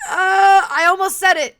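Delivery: real worked-up repeating; flustered outburst. Real, not acted. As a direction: exclaim in loud alarm at a near slip. Reacting to a near slip.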